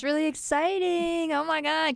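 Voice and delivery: high-pitched excited gush. Real, not acted. Co-host reacting to news of the concert, excited.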